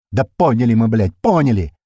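loud, irritated retort; seething. A narrator's reading. April answers radio orders, seething loudly. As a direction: snap back, fed up. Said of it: "продолжает кипятиться Апрель".

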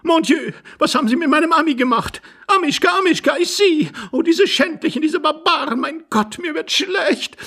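very high-pitched, hysterical lament; high, agitated lady's voice; distraught lady. A narrator's reading. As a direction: wail like a fainting society lady. Distraught lady lamenting her dog.

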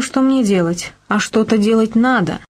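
ordinary delivery, nothing marked

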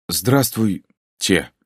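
a gulp breaking the greeting; swallowing mid sentence. A narrator's reading. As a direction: swallow hard in the middle of the word. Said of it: "сглотнув, сказал я". (сглатывая посреди слова)

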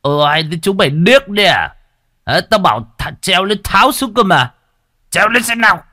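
high, loud tirade; furious cursing. Acted. Enraged chief beats villagers into climbing the tree, furious shouting. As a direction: berate the crowd at a shout. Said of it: "vừa chửi".

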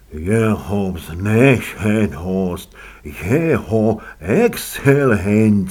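slow, drawn-out announcer voice; formal announcement. Acted. Servant announces an eminent guest to his lady, ceremonious and drawn out. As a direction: announce the guest loudly, stretching every word.

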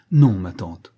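loud, low, decisive no; firm refusal. A narrator's reading. Niece denies the accusation to her aunt, firmly. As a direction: deny it firmly, falling away after the no. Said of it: "répondit fermement Vasilissa".

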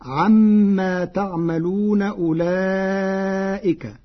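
slow, chanted tajwid recitation; Quran recitation. Acted. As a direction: stretch the vowels in recitation.

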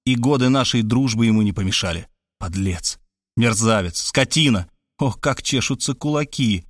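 plain reading voice

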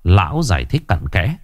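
ordinary delivery

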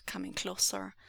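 ordinary delivery

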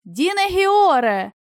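high, slow, stretched-out hail; calling out. A narrator's reading. Friends below hailing the absent-minded guard, drawn-out call. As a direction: draw the name out as a call. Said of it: "закричали знизу".

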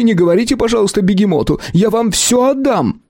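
high, rushed, scared begging; hasty frightened pleading. A narrator's reading. Shopkeeper begging the clan not to tell Begemot, high and scared. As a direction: beg them fast to stop. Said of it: "поспешил ответить Ларус".

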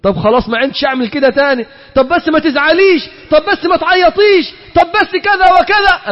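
mock weepy son begging mother; mock tearful begging. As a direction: plead quickly, voice climbing. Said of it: "تخلي الواحد يبكي ويعيط".

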